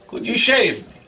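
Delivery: put-on high girlfriend voice; Igret. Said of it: "And she say".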